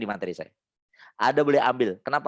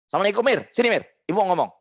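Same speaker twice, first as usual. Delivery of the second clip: put-on demo mother calling child; 6 semitones higher, 3 dB louder, faster than usual. Shown as a demonstration. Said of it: "Anda bilang".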